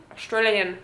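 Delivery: put-on tentative go at an Australian accent; attempted Australian accent. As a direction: try out an Australian accent at normal pitch. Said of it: "I can't do fucking accents".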